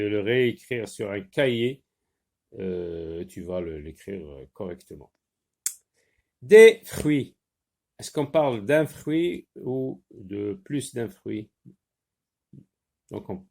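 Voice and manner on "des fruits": loud, clear dictation of the word; spoken out loud and clearly for a dictation. Shown as a demonstration. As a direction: call the words out to the class. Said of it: "dictation for the class".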